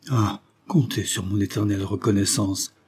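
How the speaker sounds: plain reading voice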